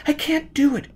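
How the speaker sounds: put-on high, tense girl's voice; frightened young woman. A narrator's reading. Gisbella protesting to Foyle that there is no air. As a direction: pitch up, sound scared.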